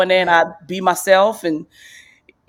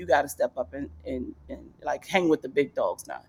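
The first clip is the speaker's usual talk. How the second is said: softer, lower, gruff self-challenge; tough inner voice; 4 semitones lower, 5 dB quieter than usual. Retold or imitated. Comic pushing herself to keep up, soft gruff challenge. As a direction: egg yourself on under your breath. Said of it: "But it's also like".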